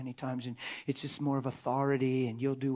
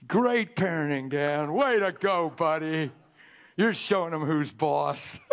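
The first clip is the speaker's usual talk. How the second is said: sarcastic self-mockery; 5 dB louder, slower than usual. Sarcastic self-mockery about past parenting.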